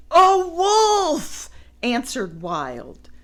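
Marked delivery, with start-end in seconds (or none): 0.1-1.5 high, loud cry; shouted alarm. Acted. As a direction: yell it out. Answered in alarm.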